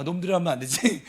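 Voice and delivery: ordinary talk, nothing marked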